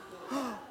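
high showy gasp of wonder; mock gasp. Shown as a demonstration. Speaker demoing a thrilled gasp for the congregation, hammed-up. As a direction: gasp like you just won an Oscar. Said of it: "do, like, pretend you won the Oscars".

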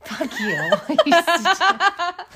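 a friend teases, she squeals back laughing; laughing retort. Real, not acted.

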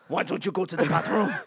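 silly mock French accent; badly faked French accent. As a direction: ask it like a hammy Frenchman. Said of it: "so funny about my accent".

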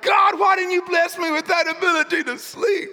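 high, loud shouted complaint; plea to God. Retold or imitated. Insomniac husband complaining to God. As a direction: cry out in frustration. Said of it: "and I'm like".

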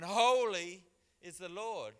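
high, slow, swelling proclamation; angels calling out. Acted. Angels thundering in Isaiah's vision of God, calling out. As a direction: boom out the first word as an angel would. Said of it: "they're going bunter, going".

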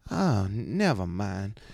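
slow, accented put-on woman's voice; mock disgusted brush-off in her accent; imitating woman giving up. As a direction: draw it out, dismissing him. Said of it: "like, almost like she's like".

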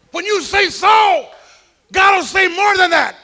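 high, slow full-force shout; preaching shout. Real, not acted. Preacher shouting the promise at the congregation. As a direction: cry it out to the whole church.

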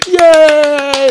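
high, loud squealed cheer; mock crowd cheer. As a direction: cheer like a dumb studio crowd. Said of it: "And then everyone goes".

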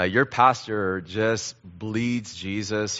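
ordinary delivery

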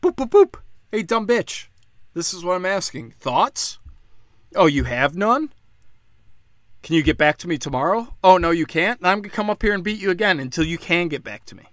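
mock taunting. Ranter acts out the governor taunting the official, mock jeering. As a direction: jeer at her like a bully. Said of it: "and said".

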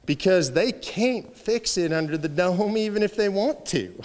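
slow Southern drawl; Jim DeMint. Retold or imitated. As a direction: imitate a Southern accent, drawing out the vowels. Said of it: "in his beautiful Southern accent".

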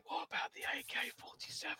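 whispered inner voice; hushed tempting whisper. Retold or imitated. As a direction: breathe it like a voice in the back of your head. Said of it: "a voice in the back of my mind going".

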